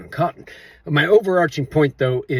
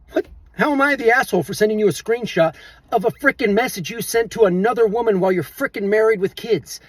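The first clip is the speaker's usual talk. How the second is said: higher-pitched, exasperated protest; indignant outburst; 8 semitones higher, 4 dB louder than usual. Real, not acted. Venting at the married friend who blamed him, indignant. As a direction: fire it at him, incredulous and worked up.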